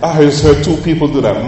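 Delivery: ordinary talk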